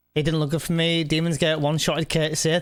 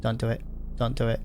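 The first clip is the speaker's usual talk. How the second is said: hushed; 6 semitones lower, 5 dB quieter than usual. Real, not acted. Pleading with the game, hushed.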